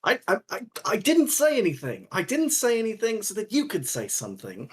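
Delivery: high, stammering self-defence; flustered protest. Acted. Roland defends himself to Pax, flustered protest. Flustered denial of having told a secret.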